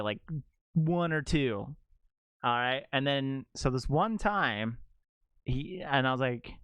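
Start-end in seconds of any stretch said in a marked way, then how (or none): none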